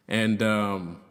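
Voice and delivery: ordinary delivery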